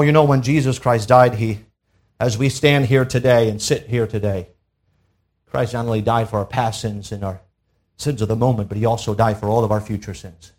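ordinary talk, nothing marked

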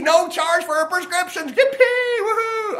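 very high, whooping celebration; loud cheer. Acted. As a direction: squeal it out in hammed-up delight. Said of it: "I don't want quite that loud".

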